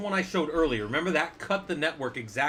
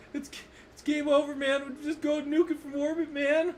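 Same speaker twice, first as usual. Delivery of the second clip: Bill Paxton impression; 12 semitones higher than usual. Teacher hams up a movie marine panicking for students, high frantic voice. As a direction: panicky high yelping "game over, man" voice, pitched way up. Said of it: "Did I do him right?".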